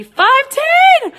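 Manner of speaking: real high, loud scream of disbelief; ecstatic scream. Real, not acted. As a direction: scream the winning number at the top. Sees her dream score.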